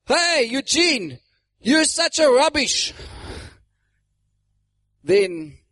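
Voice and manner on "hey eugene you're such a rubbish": high-pitched, drawn-out heckle; calling across street. Acted. A stranger heckling Eugene from across the street, calling out. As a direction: holler a mock insult from afar. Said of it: "walks out there on the other side of the street".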